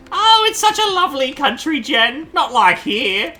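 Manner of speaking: put-on flustered old granny caught with a messy house; old lady voice. Ma greeting her son's surprise guest in a flustered old lady voice.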